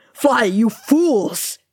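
slow, high, drawn-out cry; urgent cry. A narrator's reading. Dangling wizard urging companions to flee, crying out. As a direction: cry out a last desperate command. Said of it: "he cried".

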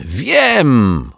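a happy eureka cry; joyful yell. Acted. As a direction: burst out with the discovery. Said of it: "wrzasnął radośnie".

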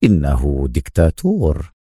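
low voice with a touch of mockery; understated, ironic pronouncement; sarcastic. A narrator's reading. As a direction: drop the pitch and mock mildly. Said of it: "بنفس لهجته التهكمية".

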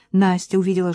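usual speaking voice

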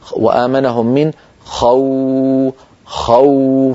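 خو held slowly to show the w; slow recitation demo. Shown as a demonstration. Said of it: "لما أقول". (تلاوة بطيئة لكلمة خوف)

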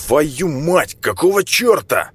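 high, blurted swearing; startled outburst. Acted. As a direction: blurt it out in shock. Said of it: "выпалил парень".

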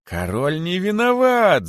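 high, drawn-out cheer; energetic crowd shout. A narrator's reading. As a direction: chant it with doubled energy. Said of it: "закричали кролики с удвоенной энергией". (крик толпы с удвоенной энергией)

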